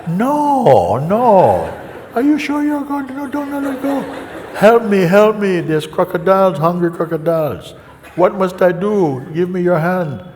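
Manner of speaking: high, loud panicked pleading; hammed-up terror, begging to be saved; mock panic. Man refuses and begs.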